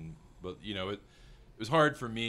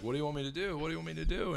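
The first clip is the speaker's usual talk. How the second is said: higher, pestering imitation; needy crew member; 8 semitones higher, faster than usual. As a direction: mimic someone nagging for instructions. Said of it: "poking you in the shoulder, like".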